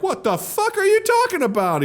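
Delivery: put-on high-pitched, indignant Cuban accent; Scarface. Tony objecting to the banker, high Cuban-accented outrage. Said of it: "You sounded about as Cuban as he did".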